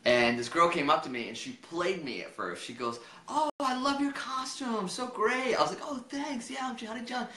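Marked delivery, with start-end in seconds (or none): 3.3-5.6 high, drawn-out mock compliment; fake-sweet gushing. Partygoer playing him along about his costume, gushing. 5.8-7.3 higher, chirpy re-enacted thanks; innocently pleased. Costumed guest thanking a girl who praised him, pleased.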